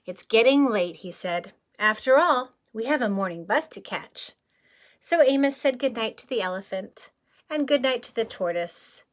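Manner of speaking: lively picture-book reading; story narration. A narrator's reading. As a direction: read it out to a child at storytime. Reading the book text aloud.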